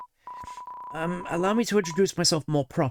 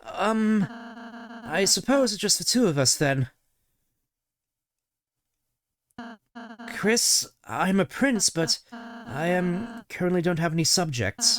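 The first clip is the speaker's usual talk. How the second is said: sweet shy prince greeting new friends; gentle prince voice; 6 semitones higher than usual. Acted. Ralsei warmly greeting Chris and Susie, lightly higher. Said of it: "I bet you were expecting me to do the Scottish voice for him".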